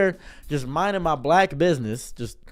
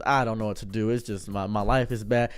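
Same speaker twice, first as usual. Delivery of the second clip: lower, softer, mild mock sob; a touch of a breakdown, lower and soft; crying robber; 8 semitones lower, 6 dB quieter than usual. Robber breaking down to the clerk, lower and tearful. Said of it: "He starts crying, breaking down".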